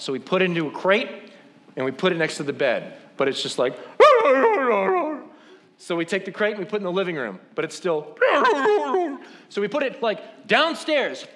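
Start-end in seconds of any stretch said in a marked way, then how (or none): 3.8-5.2 high, loud mock dog yowling; imitated dog howl. Restless puppy crated beside the bed, yowling high and loud. 8.2-9.2 high-pitched mock puppy yowl; imitated dog howl. Crated puppy in the living room, still yowling high.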